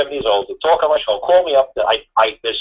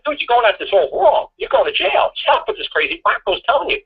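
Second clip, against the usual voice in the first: much higher, pressing urgency on "You're going to jail"; urgent warning; 10 semitones higher than usual. Retold or imitated. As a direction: urgently tell him he's wrong and headed for jail. Warning a client he'll go to jail.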